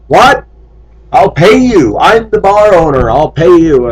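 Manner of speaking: high, loud, pushy bar owner voice; the owner waving money, pressing hard; insistent bar owner. Retold or imitated. Bar owner urging him to drink, high and loud.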